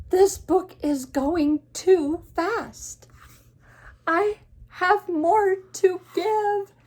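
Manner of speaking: slow, high, loud pleading; panicked storybook protest; desperate cry, every word stretched; Gerald. Acted. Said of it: "he's panicked".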